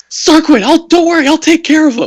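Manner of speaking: loud, high shout; protective shout. Acted. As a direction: yell it as you rush in to save him. Rushing to help friend.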